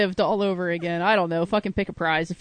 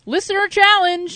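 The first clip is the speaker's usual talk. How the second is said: high sing-song call; 10 semitones higher, 4 dB louder than usual. Acted. Segment jingle.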